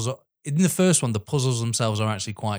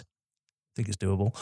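quoting Ben Gates; 5 semitones lower, 5 dB quieter, slower than usual. Retold or imitated. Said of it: "Ben Gates replies".

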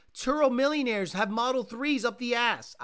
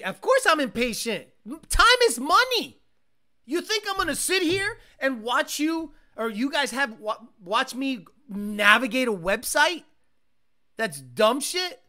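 loud, higher exasperated rant; impatient rant; 4 semitones higher, 7 dB louder than usual. Real, not acted. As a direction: rant about wasted time.

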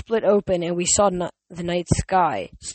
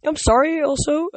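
voice cracking; 9 semitones higher, 3 dB louder than usual. Real, not acted. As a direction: pitch breaks upward suddenly. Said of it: "I apologize for my voice cracks".

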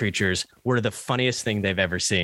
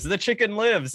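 Maui line; 10 semitones higher than usual. Retold or imitated. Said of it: "Maui says".

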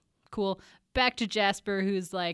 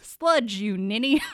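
scolding; 6 dB louder, slower than usual. Acted. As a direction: snap the correction as a cranky uncle. Acting uncle scolding niece.

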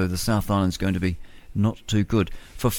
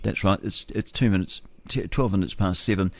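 ordinary talk, nothing marked

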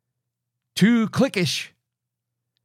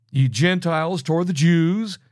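ordinary delivery

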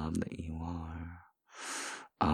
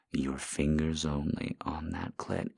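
normal talking voice

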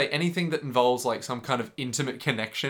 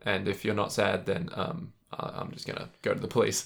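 mock menacing girl; 5 semitones lower, 5 dB quieter than usual. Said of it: "this girl that's like".

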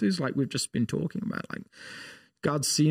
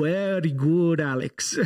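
imitating an Indian professor's accent, a bit higher; 3 semitones higher, slower than usual. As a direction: praise a student in a light Indian accent. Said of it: "still had his deep Indian accent".

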